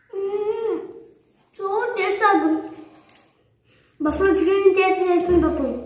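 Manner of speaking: chewing while talking; words muffled by food; mouth full of rice. Real, not acted. Seen 0: Spoonful of rice goes in at -1.0; jaw chews while speaking after.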